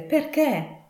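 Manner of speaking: high, pleading exclamation; exclaimed. A narrator's reading. Nephew presses his uncle for a reason, exclaiming high. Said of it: "esclamò il nipote".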